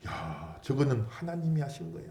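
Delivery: softly voiced amazement; hushed awe. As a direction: marvel under your breath as an onlooker. Voicing amazed onlooking nations. (나직이 감탄하듯)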